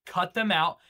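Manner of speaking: usual speaking voice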